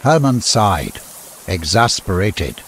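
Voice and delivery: ordinary delivery, nothing marked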